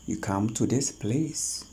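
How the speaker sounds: ordinary talk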